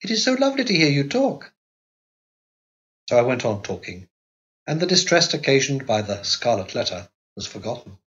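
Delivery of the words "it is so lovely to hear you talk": put-on high, light young wife's voice; Carlotta's girlish voice. A narrator's reading. Narrator voicing the young wife to her husband, high and innocent. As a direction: lift into a childlike wife asking innocently.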